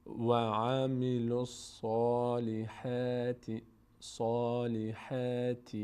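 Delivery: slow, drawn-out tajweed chant; measured model recitation with long held vowels; Quranic recitation. Shown as a demonstration. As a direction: recite slowly and evenly.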